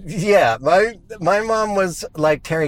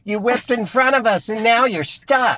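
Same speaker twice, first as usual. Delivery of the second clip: mocking; 7 semitones higher than usual. Real, not acted. Taunting a driver from the car.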